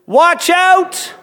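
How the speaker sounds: high, loud, full-throated warning; shouted warning. Shown as a demonstration. Preacher leads the congregation in a loud shouted warning. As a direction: belt it out so everyone joins. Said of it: "Can we all say".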